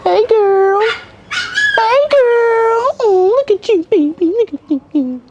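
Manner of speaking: very high, drawn-out gushing greeting; high-pitched cooing. Real, not acted. YouTuber fawning over someone he calls baby, high-pitched cooing. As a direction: coo in a squeaky high voice.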